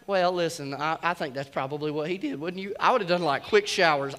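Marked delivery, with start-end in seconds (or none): none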